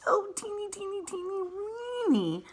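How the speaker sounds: very high squeaky tiny voice. Real, not acted. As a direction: shoot up high to show how tiny it is. Exaggerating tiny mouth for children.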